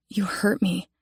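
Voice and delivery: soft hurt whisper. Acted. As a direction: breathe it out, wounded. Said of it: "I whisper back".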